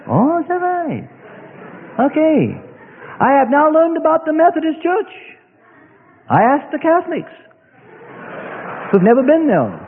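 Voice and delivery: high, wide-eyed mock naivety; mock gullible surprise. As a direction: lampoon a gullible listener.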